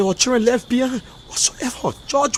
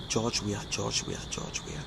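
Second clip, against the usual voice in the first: 1 quiet; 10 semitones lower, 17 dB quieter, slower than usual. Real, not acted. Calling the president's name.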